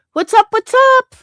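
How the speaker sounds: loud, very high-pitched "what's up"; shouted greeting. Real, not acted. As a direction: call out a big hello to the stream. Calling out greeting to viewers.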